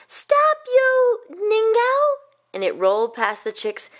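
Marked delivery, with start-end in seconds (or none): 0.1-2.2 high, loud call with drawn-out last words; shouting. A narrator's reading. Family chasing the rice cake outside, high drawn-out shout. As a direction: shout after a runaway, stretching it out.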